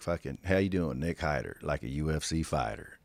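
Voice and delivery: a hint of Southern swagger, low; Southern accent. Host pretends to introduce himself as his guest, Southern accent. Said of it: "come out with my Southern accent".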